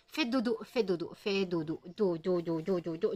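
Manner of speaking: lullaby coaxing. Real, not acted. Owner lulling her agitated cat, soft sing-song coaxing. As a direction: lull the cat to sleep gently.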